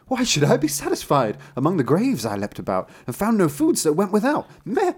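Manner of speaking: high, loud indignant goat; complaining goat. Acted. Goat answers the tailor in the stable, high bleating complaint.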